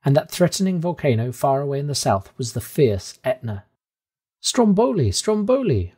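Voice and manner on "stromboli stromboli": high, thrilled cry of the name; excited repeating. A narrator's reading. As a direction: call out the name in delight. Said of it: "I repeated".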